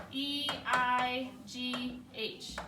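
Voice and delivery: "E I G H" as four slow, separate letters; spelled out slowly. Shown as a demonstration. Teacher reminding class of a phonics team, "E I G H" spelled slowly. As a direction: space out each letter of "E I G H".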